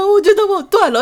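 high, loud, laughing half-sob; laughing while quoting crying. Retold or imitated. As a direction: laugh through a mock sob. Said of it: "而且我还一直哭". (又哭又笑地说)

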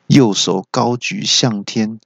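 plain reading voice

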